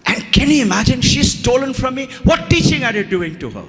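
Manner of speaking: high, heated complaint; retold indignant mother. Mother blames the teacher for her daughter stealing, acted indignant. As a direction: imitate an outraged mother taking the teacher to task.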